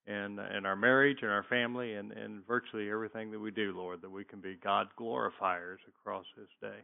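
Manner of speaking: hushed closing prayer before the lesson. Acted. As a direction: pray softly, eyes closed.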